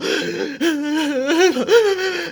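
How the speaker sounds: loud, high hammed-up crying gasps; mock sobbing. Girl reaching her dad in tears, hammed-up sobbing. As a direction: burst into exaggerated wailing sobs. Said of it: "burst into tears again".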